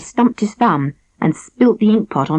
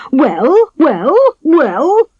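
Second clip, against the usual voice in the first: each well higher and louder, rising; impatient repeated well; 9 semitones higher, 5 dB louder, much slower than usual. A narrator's reading. King impatiently badgering his silent council. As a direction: demand an answer, climbing. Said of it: "said the king".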